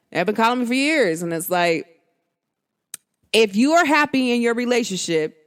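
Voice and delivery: usual speaking voice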